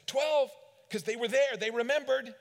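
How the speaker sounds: high, loud eager answer; eager shout. Acted. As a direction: shout it like a proud disciple. Disciples answering eagerly, Jesus' question.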